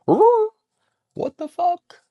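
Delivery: very high, loud squeaky dog voice; dog's startled squeal. Retold or imitated. Vlogger voicing her startled puppy in a squeaky squeal. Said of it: "He was like".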